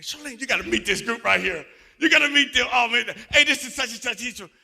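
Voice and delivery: high, loud eager introductions; hammed-up overjoyed husband; imitating his excited call. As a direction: show off the new friends to his wife. Retold excited call to wife.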